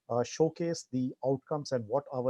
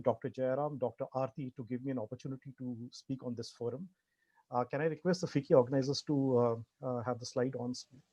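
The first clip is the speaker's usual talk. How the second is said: ordinary delivery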